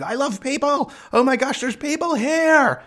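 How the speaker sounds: high excited yippy little dog; high yappy dog voice. Retold or imitated. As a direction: squeal like an overexcited small dog. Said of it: "a little yippy dog that says".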